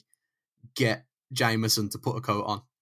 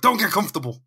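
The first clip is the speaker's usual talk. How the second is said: higher, louder mock threat; mock menacing thug; 7 semitones higher, 5 dB louder, faster than usual. Kidnapper warning the pistol-whipped Jameson, hammed-up menace. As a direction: sneer it like a movie heavy. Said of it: "It's just like".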